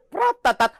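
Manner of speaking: loud mouth-made rifle burst; gunfire sound. Retold or imitated. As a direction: imitate automatic fire loudly. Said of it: "gunfire imitation".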